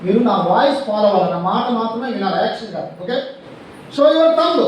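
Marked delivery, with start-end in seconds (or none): 4.0-4.6 high, loud classroom command; game leader shouting an instruction; called-out command. Real, not acted. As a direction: call it out to the whole class. Teacher calling commands in game.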